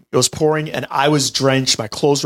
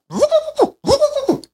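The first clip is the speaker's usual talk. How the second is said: high sputtering mower noise; mouth-made engine sputter; imitated engine. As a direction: mimic the mower failing to start. Lawnmower imitation again.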